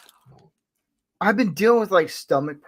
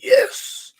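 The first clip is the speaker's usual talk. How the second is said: excited yes; 5 dB louder than usual. Retold or imitated. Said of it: "I was like".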